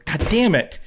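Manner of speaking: high-pitched exasperated curse; retold stress. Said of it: "I'm just stressed".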